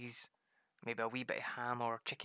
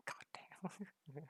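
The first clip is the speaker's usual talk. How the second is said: whisper; 5 semitones higher, 10 dB quieter than usual. Real, not acted. Awed whisper.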